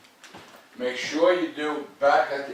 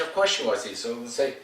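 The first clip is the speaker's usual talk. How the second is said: normal talking voice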